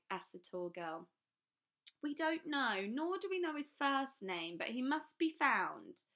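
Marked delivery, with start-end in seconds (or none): 2.0-6.0 higher, louder, slowing at the end; anxious and insistent; agitated squeak. A narrator's reading. Mister Lowe presses the children, agitated and high. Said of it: "Mister Lowe was getting agitated".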